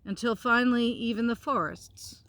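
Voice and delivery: gentle storybook narration; reading to children. A narrator's reading. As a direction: read it out like a bedtime story. Continues reading the book.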